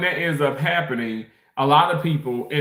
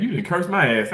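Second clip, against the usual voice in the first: ordinary talk, nothing marked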